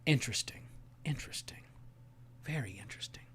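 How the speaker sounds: very soft, low murmur; hushed musing. Real, not acted. Streamer reacting to a reader's scores, musing under his breath. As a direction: drop almost to a whisper, pondering.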